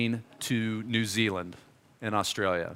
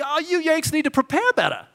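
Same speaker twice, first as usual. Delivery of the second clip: much higher, mock Australian scolding; Australian accent; 13 semitones higher than usual. The Kiwi guy telling the Yanks to prepare, in a hammed-up Australian accent. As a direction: play the local scolding the Yanks in an Aussie voice. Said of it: "So I asked this guy".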